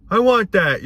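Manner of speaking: high, loud imitating a child begging; mock a greedy kid asking for a toy; whiny kid demand. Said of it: "the kids dramatize".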